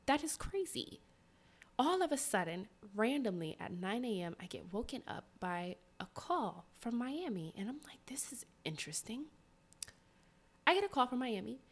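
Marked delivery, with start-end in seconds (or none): 4.4-9.2 hushed storytelling whisper. Real, not acted. Whispering the story of the morning call. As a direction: drop to a secretive whisper.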